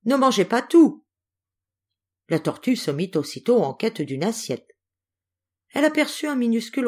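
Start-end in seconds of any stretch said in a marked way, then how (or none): 0.0-1.0 high, loud call over the distance. A narrator's reading. Antelope calls back to the turtle while leaving, loud. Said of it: "elle cria à la tortue".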